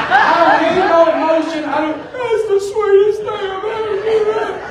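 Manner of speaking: high, hammed-up weepy voice; mock tearful. Guy caught among crying ladies at the checkout, mock tearful. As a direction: pretend you're overcome with emotion, voice way up.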